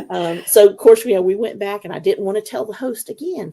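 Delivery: normal talking voice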